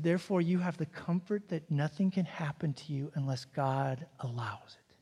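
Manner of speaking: soft unvoiced whisper, barely breathed; whispered assurance. Counselor reassuring scared young Todd at camp, in a whisper. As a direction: breathe it like a secret comfort.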